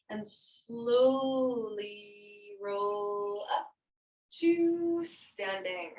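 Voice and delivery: very slow, stretched-out cue; slowly drawn out. Shown as a demonstration. Yoga teacher guiding students up to standing, slow and drawn out. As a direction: stretch each word to pace the movement.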